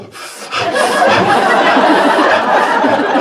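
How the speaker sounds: breathy, high giggle of delight; giddy high laugh. Retold or imitated. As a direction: giggle as the gas kicks in. Alone with nitrous mask.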